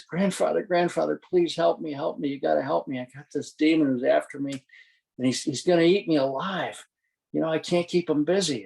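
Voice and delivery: high-pitched, urgent begging; panicked pleading. Acted. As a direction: plead frantically for someone to save you. Terrified man begs the shaman for help.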